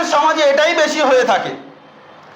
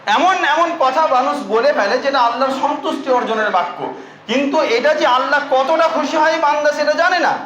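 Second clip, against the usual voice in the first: usual speaking voice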